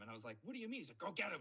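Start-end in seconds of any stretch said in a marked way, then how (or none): 0.4-0.8 high-pitched mock bewilderment; baffled panic. Acts out baffled student. 1.0-1.4 loud, high mock command; imitated exasperated order, raised voice; insistent urging. Professor's final, insistent urging.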